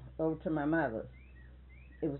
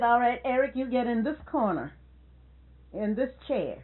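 put-on raised, firm voice sending a child to the corner; firm scolding order; 6 semitones higher, 6 dB louder than usual. Grandmother ordering Eric into the corner, firm and raised. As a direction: lay down the law to a naughty kid.

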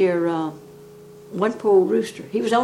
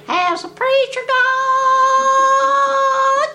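much higher, louder, sung-out crow; rooster crow; 6 dB louder, much slower than usual. Retold or imitated. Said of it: "every once in a while he'd crow".

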